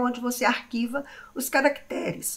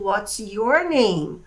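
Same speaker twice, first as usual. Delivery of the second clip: slow demo; 2 semitones lower, much slower than usual. Shown as a demonstration. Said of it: "Então eu pergunto".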